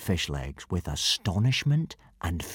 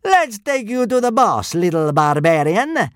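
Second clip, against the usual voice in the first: gloating soldier; 11 semitones higher, 6 dB louder than usual. A narrator's reading. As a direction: sneer it down at the captive. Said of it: "said the centurion".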